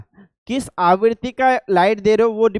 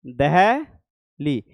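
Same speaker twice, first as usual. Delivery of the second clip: Delhi drawn out very slowly, lower; slow dictation; 6 semitones lower, much slower than usual. Shown as a demonstration. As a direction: spell out Delhi slowly for the class to write. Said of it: "Delhi लिखता है".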